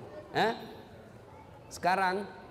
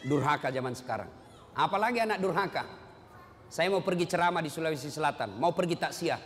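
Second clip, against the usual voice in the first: ordinary talk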